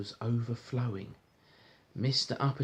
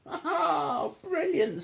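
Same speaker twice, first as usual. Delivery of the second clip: much higher, laughing delight; Mister Uppity delighted; 4 dB louder, much slower than usual. Acted. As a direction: crow with pleased laughter. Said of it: "said Mister Uppity".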